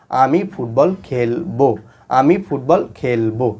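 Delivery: very slow, separated words; slow recitation. Shown as a demonstration. Teacher showing students the finished translation, slow recitation. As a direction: sound out the sentence piece by piece.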